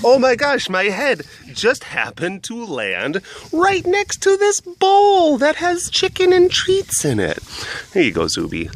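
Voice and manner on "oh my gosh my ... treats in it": high, slow put-on dog voice; voicing the dog. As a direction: play the sneaky dog making an innocent excuse, pitched high.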